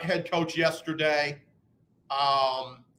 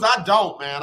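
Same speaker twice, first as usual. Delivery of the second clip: ordinary talk, nothing marked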